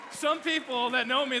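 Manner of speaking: ordinary delivery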